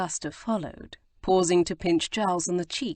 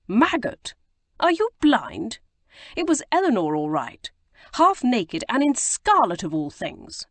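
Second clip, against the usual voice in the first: indignant outburst; 6 semitones higher, 5 dB louder than usual. Acted. Giles protests indignantly.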